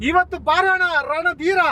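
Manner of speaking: slow, high declaimed challenge; film dialogue. Shown as a demonstration. Kidnapper feeding her the next line, drawn-out film dialogue. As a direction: stretch the line out in heroic style. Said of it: "ಒಂದ್ dialogue ಹೊಡೀರಿ".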